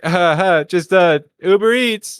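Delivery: high, loud, forced friendly laugh; mock-nervous, hesitant sing-out; nervous cheeriness. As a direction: play it harmless to a threatening group. Nervous driver answers a gang.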